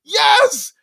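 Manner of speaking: real excited rise ending on a shouted yes; real excitement. Real, not acted. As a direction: cheer like a fan finally vindicated. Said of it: "I'm so excited when I talk like this".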